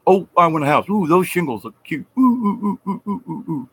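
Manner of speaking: high, gushing oohs of a shallow buyer; mock gushing buyer. Mocking people who pick cute shingles, gushing.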